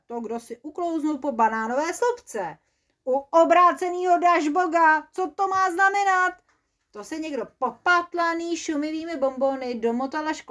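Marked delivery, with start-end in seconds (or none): 3.0-6.3 louder, higher angry cry; indignant oath. Acted. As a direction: bellow the oath in outrage. Cries from the trap.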